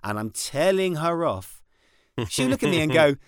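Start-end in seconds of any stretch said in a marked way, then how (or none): none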